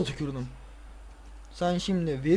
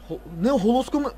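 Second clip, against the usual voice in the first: ordinary talk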